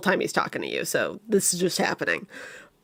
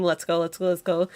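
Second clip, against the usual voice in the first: ordinary delivery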